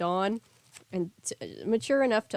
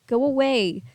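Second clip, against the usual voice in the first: loud, upset yell to go away; angry shout; 6 semitones higher, 5 dB louder than usual. A narrator's reading. Sunny yelling at the knock on her door, loud and upset. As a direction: shout through the closed door.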